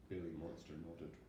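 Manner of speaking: usual speaking voice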